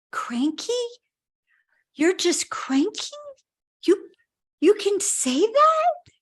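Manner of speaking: high, rising disbelieving questions, slow and drawn out; imitating someone astonished by a simple admission; retold incredulity. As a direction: repeat it back in growing amazement. Retelling his amazed, tearful reply.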